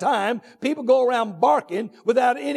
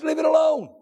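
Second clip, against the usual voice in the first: sudden raised, higher call; preaching shout; 5 semitones higher than usual. Real, not acted. Preacher to congregation, a raised, higher exhortation. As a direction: hit it hard like a pulpit command.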